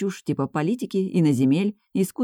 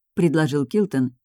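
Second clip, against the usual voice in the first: ordinary delivery, nothing marked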